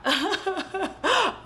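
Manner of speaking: high, laugh-like fake sobs; mock sobbing. Storyteller showing how the heroine cries, mock sobbing. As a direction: imitate crying in quick high bursts. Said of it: "crying just like that".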